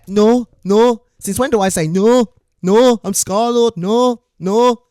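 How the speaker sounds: loud, high-pitched "no"; emphatic protest. Real, not acted. Streamer catching out his impersonator, loud emphatic protest. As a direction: protest loudly, pitch jumping up. Excitedly denying the impersonator's slip.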